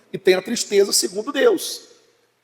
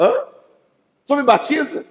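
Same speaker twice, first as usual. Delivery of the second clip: puzzled pastor; 5 semitones higher than usual. Retold or imitated. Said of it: "Aí eu".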